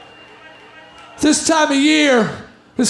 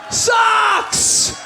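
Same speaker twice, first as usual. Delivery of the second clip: disgusted socks; 7 semitones higher than usual. Shown as a demonstration.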